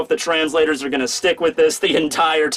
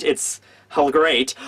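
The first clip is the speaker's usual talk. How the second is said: ordinary talk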